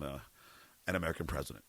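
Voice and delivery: ordinary talk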